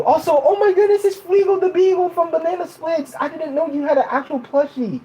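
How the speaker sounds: high-pitched delighted exclamation; excited recognition. Real, not acted. Spots a favourite character plushie.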